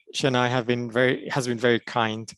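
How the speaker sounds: ordinary delivery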